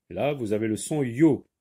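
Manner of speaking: ordinary delivery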